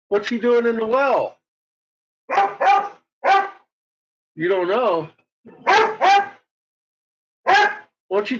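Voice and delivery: high, eager pretend chat with the dog; mock Lassie talk. Owner joking with her dog about Timmy in the well, high playful voice. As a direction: play along like the dog is telling you Timmy's in the well.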